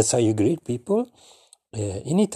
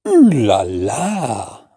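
loud, drawn-out ooh la la; slow, delighted ooh la la; 5 dB louder, much slower than usual. Shown as a demonstration. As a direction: savour the surprise. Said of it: "Try, try again".

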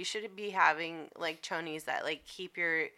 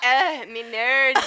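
slow, louder Scouse "me nerds"; mock Liverpool accent; 6 semitones higher, 8 dB louder, slower than usual. Joking with co-host, slow loud Liverpool accent. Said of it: "from Liverpool".

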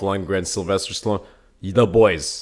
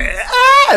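excited crowd yell; 9 dB louder than usual. Retold or imitated. Cheering crowd imitation.

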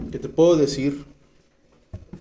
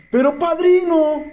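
hammed-up protest, voice pitched up; pleading excuse; 7 semitones higher than usual. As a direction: act out an addict begging the sponsor. Acts out addict pleading with sponsor.

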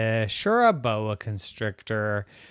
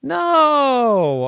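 high, loud, drawn-out no; long wail of "no"; emphatic refusal; 13 semitones higher, 7 dB louder than usual. A narrator's reading. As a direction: refuse like an exasperated child. Girl rejects another substitute animal.

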